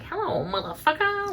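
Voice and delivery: high, soft little-kid mimicry; imitating cussing kids. Kids on the playground cussing at the girls, mock kid voice.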